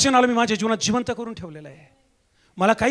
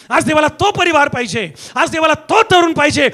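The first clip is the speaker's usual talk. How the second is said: impassioned plea driven into forceful sermon; fervent preaching; 3 semitones higher, 7 dB louder than usual. Real, not acted. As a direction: cry it out with insistent, repeated punches. Prayer turning to full-force preaching. (आवेशपूर्ण, जोरदार प्रवचन)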